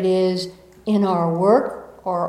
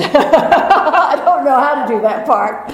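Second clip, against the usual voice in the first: laughing; 5 semitones higher, 8 dB louder than usual. Real, not acted. Laughing at being serious.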